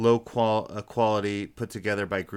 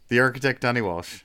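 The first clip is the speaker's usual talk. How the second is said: ordinary talk, nothing marked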